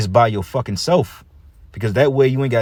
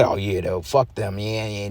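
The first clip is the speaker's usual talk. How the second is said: softer, offhand brush-off; mock dismissive; 6 dB quieter, slower than usual. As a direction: toss it away under the voice, as if unbothered. Acts out brushing off family loss.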